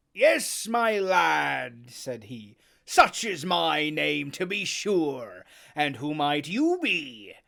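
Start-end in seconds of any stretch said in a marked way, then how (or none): 0.1-1.9 put-on hearty, louder old sailor; Silver greeting. Silver greets the new boy, hearty and loud. 2.8-7.3 slow, hearty sea cook; Silver speaking. Acted. Silver to Jim, slow and hearty.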